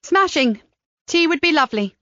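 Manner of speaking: delighted, accepting the tea; Kat's voice. A narrator's reading.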